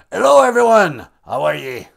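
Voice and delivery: booming greeting in a put-on accent; Kichimanadu. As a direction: hail everyone as an accented god. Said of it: "not sure why he has that accent".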